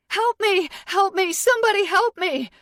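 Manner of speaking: screaming for help an octave up; high, loud cries of terror; terrified scream. Acted. Captive woman calling for rescue, terrified scream. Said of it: "I start to scream".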